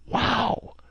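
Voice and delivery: a high, amazed wow; excited exclaim. A narrator's reading. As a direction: gasp it out in wonder. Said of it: "Ned Land exclaimed".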